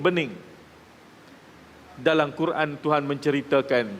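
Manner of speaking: ordinary talk, nothing marked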